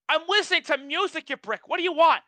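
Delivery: high, indignant comeback; irate retelling. Retold or imitated. Worker retelling a comeback to someone talking over music, irate. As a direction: pitch it high and exasperated at the interrupter. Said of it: "I was so irate".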